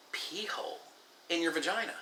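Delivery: hushed, high-pitched puzzled question; mock incredulous. Young woman questioning his anatomy claim, mock incredulous. She questions him in disbelief.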